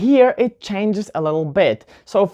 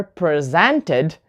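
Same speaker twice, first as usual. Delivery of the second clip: presented as pre-ZEN-ted; verb stress. Shown as a demonstration. Teacher giving a verb example to learners, presented stressed on ZEN. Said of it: "To present is a verb".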